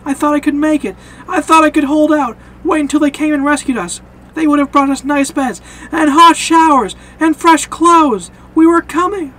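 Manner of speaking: loud, high-pitched bitter tirade; impassioned, anguished outburst; acted despairing rant. Shane's despairing rant at Rick.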